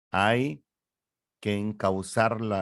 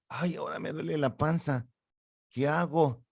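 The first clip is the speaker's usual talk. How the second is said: put-on whiny baby voice; 7 semitones higher than usual. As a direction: whimper it like a baby with tummy ache. Baby complaining in story.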